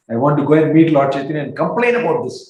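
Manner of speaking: loud, fast indignant vow; march off to complain. Retold or imitated. Nephews vowing to complain.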